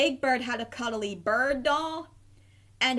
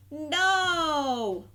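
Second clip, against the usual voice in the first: higher, drawn-out little wail of despair; 5 semitones higher than usual. A narrator's reading. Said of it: "wailed Harry".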